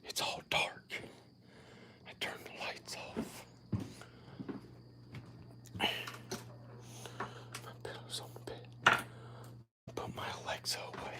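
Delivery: hushed unvoiced whisper in the dark; breathy night-time whisper. Real, not acted. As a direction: whisper so as not to wake anyone.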